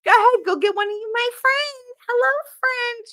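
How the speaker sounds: high, fast, sugary mock-sweet voice; imitating Veronica. Teller mimics Veronica charming store clerks, high mock-sweet. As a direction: send them off in a sing-song coo, way up high. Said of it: "She's always been like that".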